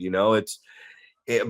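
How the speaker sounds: ordinary talk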